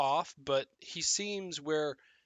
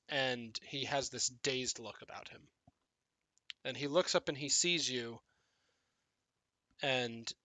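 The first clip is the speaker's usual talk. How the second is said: ordinary delivery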